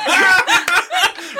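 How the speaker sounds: high bursting laughter; loud laugh. Real, not acted. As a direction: laugh hard. Laughing at surprise attack.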